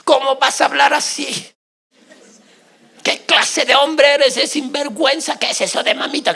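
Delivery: loud scolding rant at a husband; mock angry wife yelling at her husband. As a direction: tell him off, loud and indignant. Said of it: "como algunos dicen".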